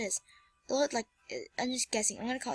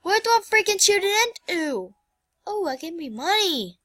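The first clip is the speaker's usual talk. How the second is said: usual speaking voice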